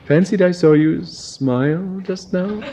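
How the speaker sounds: high hearty toff, slow on splendid; hearty baronet. Acted. Baronet heartily flattering Adrian, high toff voice. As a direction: gush with false heartiness. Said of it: "said the baronet heartily".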